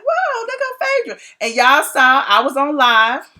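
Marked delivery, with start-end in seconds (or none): 0.0-1.2 high-pitched mock wonder; mock surprise. Blogger spotting Phaedra at a party, mock surprise. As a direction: spot someone and announce it up high.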